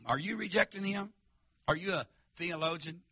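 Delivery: usual speaking voice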